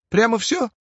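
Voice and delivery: high, doubting question; doubtful. A narrator's reading. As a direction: squint and doubt it. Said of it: "прищурился Васечкин".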